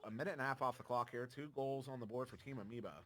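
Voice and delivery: usual speaking voice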